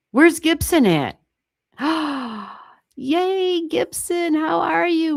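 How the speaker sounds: bright, high, delighted hello to Gibson; light up as a friend shows up in chat; excited greeting. Real, not acted. Astrologer greeting a returning viewer in chat, thrilled and high.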